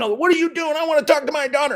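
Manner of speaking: high-pitched mock yell, imitating the angry dad; retold scream. Angry dad confronting the coach, high mock yell. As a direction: squeal it out like an outraged parent. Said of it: "Dad came screaming".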